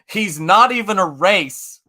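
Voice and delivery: loud, high retold exclamation; mock outburst. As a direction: exclaim it, imitating a shocked mother. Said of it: "she turned to me and goes".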